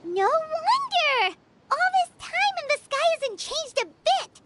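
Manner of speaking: high, eager outburst of discovery; excited realization. Acted. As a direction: blurt it out, suddenly getting it. Sudden realization in the fake memory.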